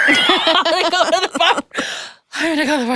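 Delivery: high, laughing repeat of the joke. Retold or imitated. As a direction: mom joking she needs a drink, laughing. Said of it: "I'm like".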